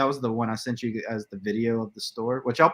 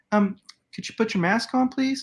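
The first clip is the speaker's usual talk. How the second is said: high-pitched polite request, rising on please; high, tentative mimicry of a store worker; mock-polite store worker; 10 semitones higher than usual. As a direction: imitate a fussy clerk in a raised pitch.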